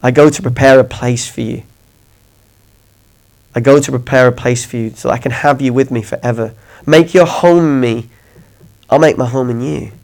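soft, gentle voice of Jesus; tender Jesus. Acted. Preacher voicing Jesus to his listeners, soft and tender. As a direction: speak it softly, like a loving promise.

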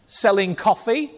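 ordinary talk, nothing marked

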